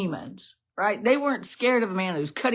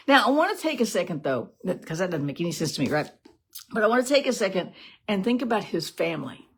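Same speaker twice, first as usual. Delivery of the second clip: ordinary delivery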